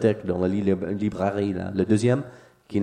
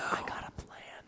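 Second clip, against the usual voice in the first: whispering; 19 dB quieter than usual. Pretend. Conspiratorial whispered plan.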